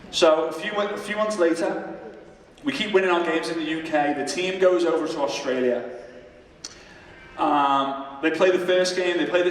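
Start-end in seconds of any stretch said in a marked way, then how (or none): none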